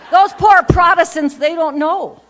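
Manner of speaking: loud, hammed-up pity; a teasing, overdone lament; mock pity shout. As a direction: cry out in mock sorrow for them.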